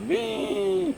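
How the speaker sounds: high playful squeal; excited whee. Real, not acted. About to get into plunge.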